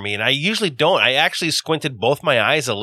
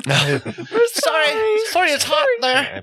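much higher put-on voice, laughing through the apology; mock apologetic worker; slower than usual. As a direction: squeak out a sorry as a flustered little worker. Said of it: "And then they apologize".

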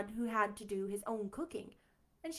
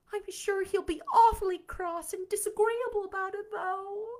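moaning; 9 semitones higher, 5 dB louder than usual. Acted. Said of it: "moaned Anne".

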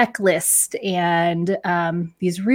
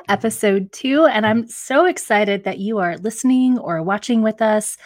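usual speaking voice

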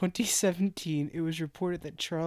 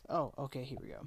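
ordinary talk

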